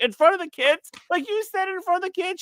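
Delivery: high-pitched mock shock; mortified wife. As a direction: imitate her appalled, rising high in pitch. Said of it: "she was, like, mortified".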